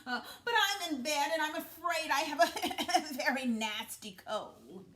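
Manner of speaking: high, loud fake granny with a sly laugh; wolf in grandmother's bed explains, high and loud with a laugh; wolf posing as grandmother. A narrator's reading. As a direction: sell the sick-granny act, chuckle slipping through.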